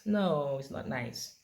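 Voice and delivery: usual speaking voice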